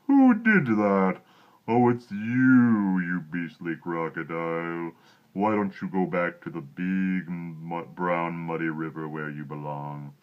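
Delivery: big deep elephant voice. Acted. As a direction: scold the croc slowly and grandly. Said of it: "in his big, deep voice".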